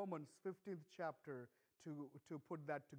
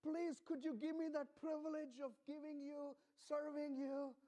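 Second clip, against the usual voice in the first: high, earnest begging voice, raised well above normal; pleading; 9 semitones higher than usual. Retold or imitated. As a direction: plead like eager believers asking a favour. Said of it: "they're begging".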